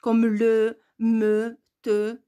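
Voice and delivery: le, me, te with a full, voiced e; sounded E. Shown as a demonstration. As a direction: give le, me, te each a drawn-out 'euh'. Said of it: "Ce n'est pas sonore".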